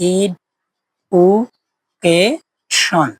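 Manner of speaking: ইড, উ, কে, শন spoken as separate beats with pauses; syllable by syllable. Shown as a demonstration. Teacher repeating education's four syllables to the class. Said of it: "আমি চারবারে উচ্চারণ করলাম".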